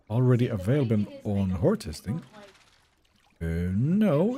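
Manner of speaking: normal talking voice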